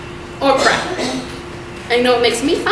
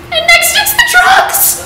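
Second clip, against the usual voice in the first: mock mother climbing higher to a shriek; panicking mom; 9 semitones higher than usual. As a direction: build the worry line by line.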